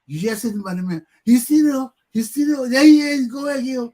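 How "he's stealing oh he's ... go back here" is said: crowd crying thief. Retold or imitated. Crowd crying thief, doing nothing.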